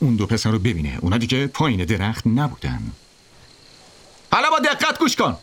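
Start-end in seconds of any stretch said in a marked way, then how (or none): 4.3-5.4 high-pitched bully's command; shouted instruction. A narrator's reading. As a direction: call up high, demanding attention.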